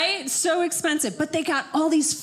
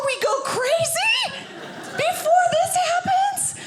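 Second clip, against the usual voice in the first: mock shock; 12 semitones higher than usual. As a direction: shriek it in fake disbelief. Mock shocked women's outburst.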